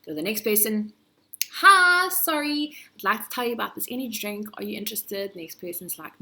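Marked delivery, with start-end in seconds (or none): 1.6-5.3 much higher and louder, rushed pitch, fast; frantic sales pitch. Retold or imitated. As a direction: shout a desperate pitch at passers-by, fast. Salesperson getting more desperate.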